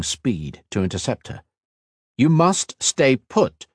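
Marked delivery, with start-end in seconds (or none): 2.2-3.7 slow, high, emphatic order; insist, stretching each word; insistent. A narrator's reading. Healer stopping Kat at the exit, insistent. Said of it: "he insisted".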